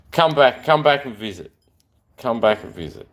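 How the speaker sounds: ordinary talk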